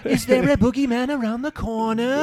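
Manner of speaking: very high, loud fake-British gasp of a word; hammed-up scandalised English accent; mock British horror. Co-host playing a shocked Briton, high mock accent. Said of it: "we made a valiant effort".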